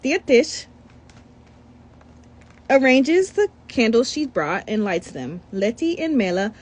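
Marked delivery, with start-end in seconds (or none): none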